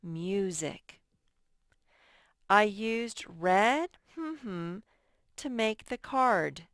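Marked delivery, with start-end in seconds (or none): 2.5-6.6 loud slow dictation. Shown as a demonstration. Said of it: "teacher dictates sentence".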